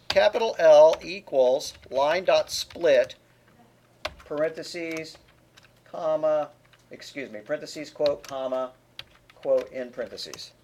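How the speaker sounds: slow, deliberate dictation of code; dictating. Shown as a demonstration. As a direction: spell the code out slowly for typing. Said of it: "dictating code line to class".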